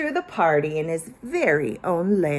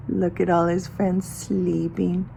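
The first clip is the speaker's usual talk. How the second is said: usual speaking voice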